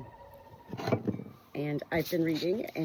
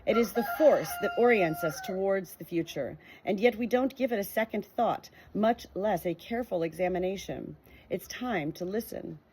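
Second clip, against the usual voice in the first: clear, steady read-aloud voice, a little higher and brighter than her chat; 4 semitones higher, 3 dB louder than usual. Acted. Sharing a book's introduction with her viewers, in a clear read-aloud voice. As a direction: read the book's words out to your viewers, evenly paced.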